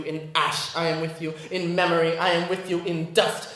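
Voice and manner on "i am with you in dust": ordinary talk, nothing marked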